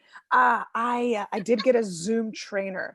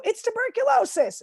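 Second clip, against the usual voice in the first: cheery joke; 12 semitones higher than usual. Retold or imitated. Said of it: "I go".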